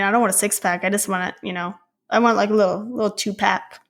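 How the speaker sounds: usual speaking voice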